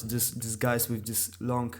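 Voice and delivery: ordinary delivery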